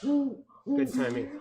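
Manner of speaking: high, slow, playful praise. Real, not acted. Praises owl's hoot timing.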